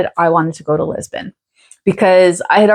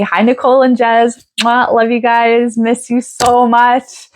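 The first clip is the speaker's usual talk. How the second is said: higher, drawn-out fond greeting; affectionate shout-out; 4 semitones higher than usual. Real, not acted. Vlogger greeting Camino friends in England, affectionate. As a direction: send love slowly in a lifted voice.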